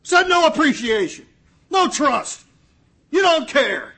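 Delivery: loud, high, exasperated cry of giving up; fed-up outburst. Pretend. Christ giving up on the ungrateful disciples, loud exasperated outburst. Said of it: "had thrown up his hands".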